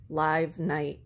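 ordinary delivery, nothing marked

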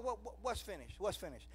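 ordinary talk

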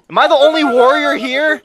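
high, loud indignant yell; hammed-up warrior's cry; battle shout. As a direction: call out the whole team for not fighting. Rallying teammates in a fight.

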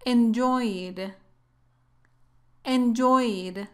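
"Enjoyed" in two syllables, ending straight on d; careful "Enjoyed", stress on the joy; silent e demo. Shown as a demonstration. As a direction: model "Enjoyed" slowly with no e before the d. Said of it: "La e no tiene sonido".

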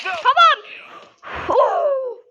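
high, loud burst of excitement; excited gamer whoop; excited yelp. Real, not acted. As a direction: whoop at a lucky moment. Sudden in-game moment.

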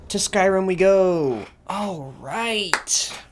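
loud, high heroic call; rally the party onward; rallying cry. Pretend. Setting off on adventure.

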